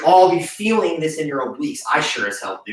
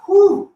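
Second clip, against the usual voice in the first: relieved whew; 5 dB louder than usual. Real, not acted. Exhale after hard set.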